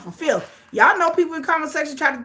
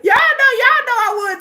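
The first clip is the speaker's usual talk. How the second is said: excited insistence; 12 semitones higher, 8 dB louder than usual. Real, not acted. Insists while laughing.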